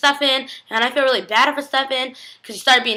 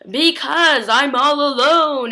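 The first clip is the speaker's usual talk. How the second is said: loud, slow, higher-pitched lament; mock forlorn wail; 5 semitones higher, 5 dB louder, much slower than usual. Retelling a lonely character's confession, exaggerated forlorn wail. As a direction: drag it out as a hammed-up complaint.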